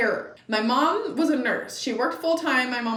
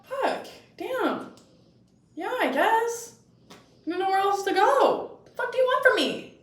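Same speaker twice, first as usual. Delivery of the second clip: put-on sulky teen; 10 semitones higher than usual. Her teen self answering back.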